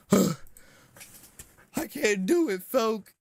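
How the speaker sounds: high, very slow sighing moan; weary whine. Real, not acted. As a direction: moan it out, completely drained. Sighing, refusing to pick again.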